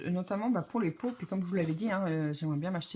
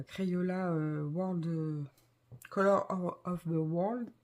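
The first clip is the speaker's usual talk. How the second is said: halting English with a French accent; hesitant, French-accented English; self-mocked English accent; slower than usual. Real, not acted. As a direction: stumble through the English name in a French accent. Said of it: "Vous entendez mon superbe accent anglais".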